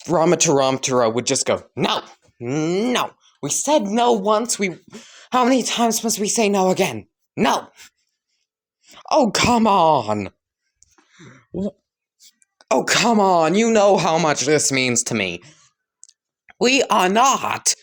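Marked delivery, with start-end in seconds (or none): 1.8-7.7 high, insistent protest; exasperated refusal. Acted. Character repeatedly refuses All Might. 9.1-15.4 higher, whiny appeal; pleading protest. Acted. All Might pleads after being refused.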